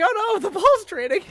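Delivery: high, loud alarmed cry; sudden panic. Retold or imitated. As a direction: realize the ball is gone. Voicing his panicked self.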